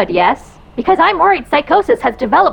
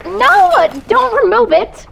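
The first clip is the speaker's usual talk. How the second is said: panicked; 11 semitones higher, slower than usual. Acted. Panicked as mask pulled.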